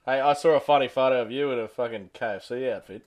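ordinary talk

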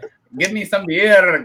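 loud, high fake German accent; boisterous comic German voice; mock German accent. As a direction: demand it like a hammed-up German. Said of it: "Germany. I'll be like".

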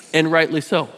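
ordinary delivery

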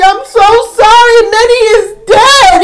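high, wailing mock-crying apology; tearful confession. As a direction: break the bad news in a high wail. Retold tearful confession to owner.

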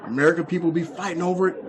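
ordinary talk

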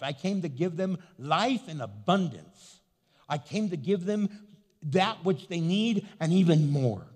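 loud, building declaration in Jesus' voice; fervent preaching. Acted. Pastor voicing Jesus to the congregation, loud and triumphant. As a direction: proclaim each I came like a crescendo.

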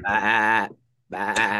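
bleating like a goat. Shown as a demonstration. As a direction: bleat it out, bah bah. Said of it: "let's hear your best goat impression".

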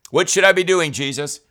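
loud, high-pitched imploring question; acted plea. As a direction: call out to Jesus as a worried believer. Voices a believer asking Jesus.

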